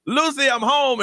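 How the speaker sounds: high, light sing-out; mock homecoming call. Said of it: "two things that I say".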